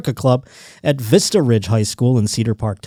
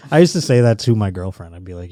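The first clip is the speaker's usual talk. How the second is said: usual speaking voice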